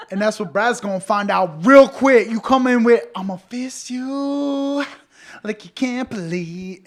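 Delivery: high, loud taunt; triumphant, hammed-up shouting; bellowed mock threat. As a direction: draw out the last word of the threat. Boasting threat at imagined Brad.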